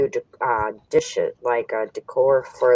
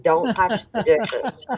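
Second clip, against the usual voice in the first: mock stern warning; 2 semitones higher, slower than usual. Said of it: "It was like".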